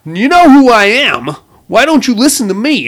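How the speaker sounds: a hammed-up, desperate Johnny demanding to be heard; exasperated plea. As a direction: plead with him, drawing each word out. Johnny insists the rich guy listen.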